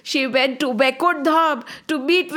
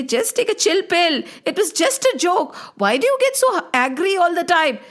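higher, mocking big-brother tone; teasing older brothers; 7 semitones higher than usual. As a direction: tease the touchy youngest.

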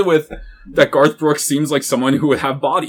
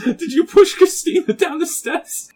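laughing read; slower than usual. Acted. Reading mock comment, laughing.

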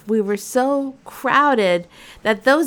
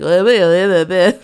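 louder, odd put-on man's voice; creepy driver; 7 dB louder than usual. Creepy driver dismissing mom, sleazy put-on voice. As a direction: play the sleazy driver brushing it off. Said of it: "he's like".

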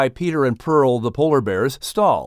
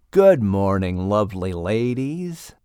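snake voice; 5 semitones lower, slower than usual. Acted. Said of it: "Charlie replied".

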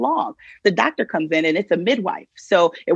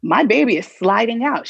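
normal talking voice

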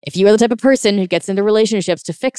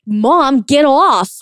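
put-on childish shout, higher and louder; bratty child snapping at mom; 7 semitones higher, 5 dB louder, much slower than usual. Shown as a demonstration. Triggered adult snapping like a child at mom. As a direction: lash out like a triggered kid. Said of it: "you're literally from a child place and you're like".

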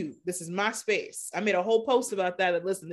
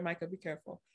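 quiet parental aside; 3 semitones lower, 13 dB quieter, faster than usual. Real, not acted. Podcaster pausing to caution a young son, quiet aside. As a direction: drop your voice away from the listeners. Parent breaks off to child nearby.

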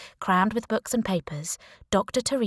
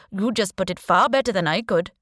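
put-on Indian accent; 5 dB louder than usual. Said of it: "he said in a strong Indian accent".

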